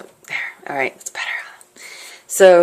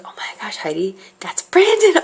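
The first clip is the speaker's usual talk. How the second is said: excited whisper; 12 semitones higher than usual. Retold or imitated. Spotting her crush across street.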